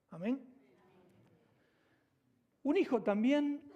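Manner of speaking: loud, high, emphatic sermon voice, hammering each repeated line; full-force preaching. Acted. Pastor driving home the point to his congregation, loud and emphatic preaching.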